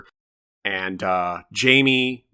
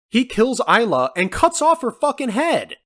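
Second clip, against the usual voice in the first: exasperated at the absurdity; incredulous; 11 semitones higher, 4 dB louder than usual. Real, not acted. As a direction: pitch climbing high in disbelief. Incredulous rant about a plot hole.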